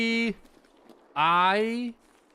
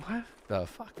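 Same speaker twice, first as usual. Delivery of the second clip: baffled; 9 semitones higher, 8 dB quieter than usual. Acted. Acts out a baffled listener.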